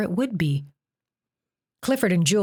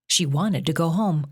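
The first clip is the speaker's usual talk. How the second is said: ordinary delivery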